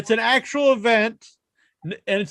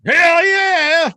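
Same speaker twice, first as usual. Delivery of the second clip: excited cheer; 9 semitones higher, 6 dB louder than usual. Real, not acted.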